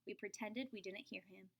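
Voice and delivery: ordinary delivery, nothing marked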